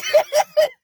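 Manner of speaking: high, loud burst of laughter; loud shrieking laugh. Real, not acted. Laughing at own mangled words, loud shrieking laugh. After flubbed tongue twister.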